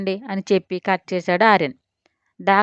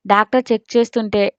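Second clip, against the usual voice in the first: ordinary delivery, nothing marked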